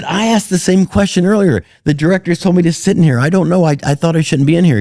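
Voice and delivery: high, fast, flustered excuse; sheepish retold self. Comic explaining to a film star, flustered and high. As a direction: talk yourself out of trouble in a hurry.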